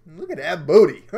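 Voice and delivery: loud laughing exclamation; cheeky laugh. Real, not acted. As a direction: crack up at the doll's behind. Laughing at doll.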